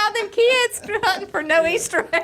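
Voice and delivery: high, loud, laughing through it; can hardly get it out for laughing; cracking up at the prank. Real, not acted. Laughing prank story telling.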